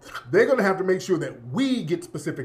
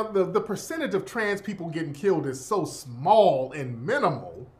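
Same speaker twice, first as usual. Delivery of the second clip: ordinary delivery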